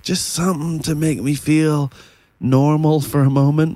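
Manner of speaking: weary plea. Retold or imitated. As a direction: beg for a moment of normal. Quoting weary parent wish.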